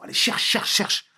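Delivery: lightly acted voiceless commands to a dog; imitated dog handler. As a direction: urge the dog on, rapid-repeat mock command. Imitates handlers urging sniffer dogs.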